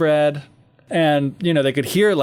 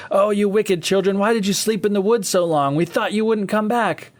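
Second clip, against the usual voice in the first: higher, hammed-up scolding stepmother; 7 semitones higher than usual. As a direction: scold the children in a higher put-on voice. Stepmother voiced in retold story.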